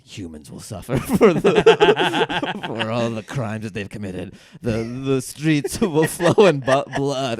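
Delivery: put-on evil baby laughing. Cohost voicing a sinister baby whiteboard, laughing through it. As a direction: laugh through a doom prophecy.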